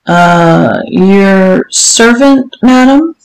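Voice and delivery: hesitant, drawn-out answer rising to a question; unsure guess. Acted. Servant guessing the wanted answer.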